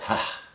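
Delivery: "Ha" as a soft puff of breath. Shown as a demonstration. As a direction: exhale on "Ha", softly. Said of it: "He is a breath".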